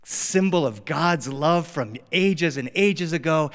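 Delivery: ordinary delivery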